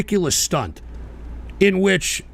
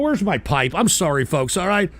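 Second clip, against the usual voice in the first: ordinary delivery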